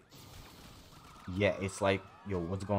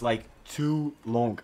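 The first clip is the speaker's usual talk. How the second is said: usual speaking voice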